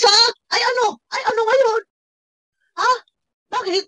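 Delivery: high-pitched "so what" challenge; defiant retort. Real, not acted. Reactor answering boast about Kuya Bal, defiant. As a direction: throw it back, pitch jumping up.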